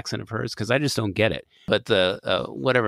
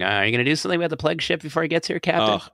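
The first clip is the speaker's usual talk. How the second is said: higher-pitched, rushed mock pestering; imitating nagging chancellor; 6 semitones higher, much faster than usual. Chancellor repeatedly calling the captain about the plague ship, mock nagging. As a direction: badger the captain in a quick, raised mock voice. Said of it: "keeps calling up and going".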